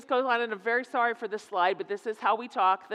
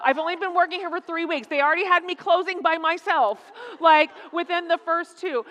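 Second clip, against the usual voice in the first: higher, louder, urgent protest; insistent mock self-justification, pitched up; building his case; 6 semitones higher, 4 dB louder than usual. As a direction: imitate a son pleading his excuses. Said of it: "He's building his case".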